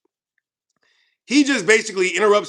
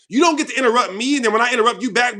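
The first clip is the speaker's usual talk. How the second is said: usual speaking voice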